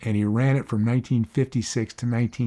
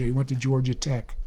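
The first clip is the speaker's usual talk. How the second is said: normal talking voice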